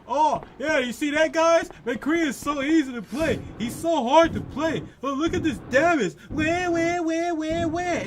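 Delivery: high, loud sarcastic imitation; mocking whiny player. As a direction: ham up the bragging complainer. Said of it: "He's like".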